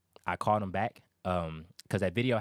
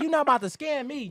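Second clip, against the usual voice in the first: much higher and louder hammed-up indignation; mock outraged demand; 5 dB louder than usual. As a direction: shout it like an offended seller, half joking. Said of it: "the attitude he had".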